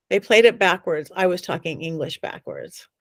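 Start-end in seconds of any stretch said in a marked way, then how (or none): 1.1-2.9 low, whispery aside; hushed reveal. Real, not acted. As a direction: drop to a near whisper for the eerie twist. Hushed reveal of the eerie twist.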